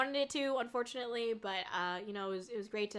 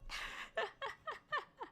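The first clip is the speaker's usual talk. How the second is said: high laugh; 13 semitones higher, 9 dB quieter than usual. Real, not acted. Laughing at own joke.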